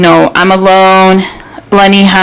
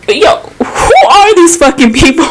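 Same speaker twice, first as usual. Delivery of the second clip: laughing outrage; 8 semitones higher than usual. Real, not acted. Outraged at the clinic mix-up.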